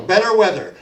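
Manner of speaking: loud lyric cue between sung lines; called-out cue. Real, not acted. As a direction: call it out loudly over the singing. Calls next chorus words to singers.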